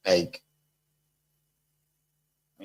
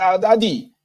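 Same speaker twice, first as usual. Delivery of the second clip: much higher, worked-up yelling; panicked accusing voter; 10 semitones higher, 4 dB louder than usual. Retold or imitated. Voter confronting the rice sharer, high and agitated. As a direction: cry out at the rice sharer in alarm. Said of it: "They say".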